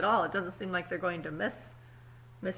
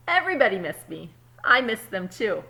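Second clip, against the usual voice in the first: put-on calm storybook reading to kids; story narration; 10 dB louder than usual. A narrator's reading. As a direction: read it like a picture book at story time. Reads the story aloud.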